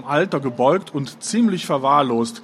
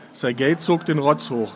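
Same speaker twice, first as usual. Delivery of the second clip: ordinary delivery, nothing marked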